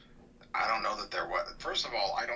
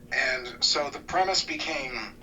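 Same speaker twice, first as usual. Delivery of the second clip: normal talking voice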